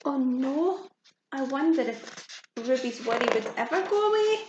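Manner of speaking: ordinary delivery, nothing marked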